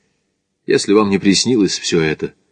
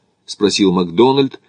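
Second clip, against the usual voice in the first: usual speaking voice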